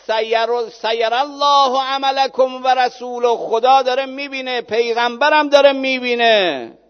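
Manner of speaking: loud, high sermon climax; preaching at full force. Acted. Preacher at full force, Quran story. (موعظه با صدای بلند و پرشور)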